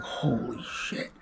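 a slow, hushed, stunned curse; hushed shock. Retold or imitated. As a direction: breathe it out, stunned by the near miss. Bullet just missed him.

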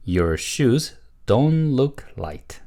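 slow, word-by-word model statement; model reading. Shown as a demonstration. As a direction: read slowly for learners to repeat. Teacher models answer for repetition. (천천히 또박또박 읽는 시범)

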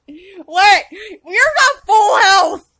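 loud, slow alarmed call; alarmed shout. Real, not acted. Gamer alarmed at teammate's health, loud shout.

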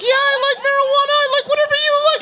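put-on very high falsetto squeak of a nervous teen; squeaky eager voice. Teen answers the girl he likes, high squeak. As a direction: squeak it out like a starstruck boy. Smitten teen says yes.

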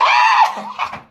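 a sudden, high, breathy shriek of laughter; loud burst of laughter. Real, not acted. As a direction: crack up hard at the punchline. Laughing at her own story.

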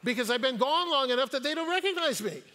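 ordinary delivery

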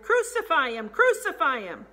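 loud, high-pitched mob chant; crowd shout. A narrator's reading. Priests and police on seeing him, shouting. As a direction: shout the demand hard, then hammer it home. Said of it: "they shouted".

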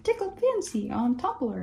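high, playful site-name voice; mock sing-song tagline. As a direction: read the name like a chirpy label. Imitating the site's tagline.

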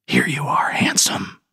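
soft menacing whisper. A narrator's reading. Narrator whispering a threat over the beaten trucker. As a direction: breathe it as a cruel taunt. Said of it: "I whispered".

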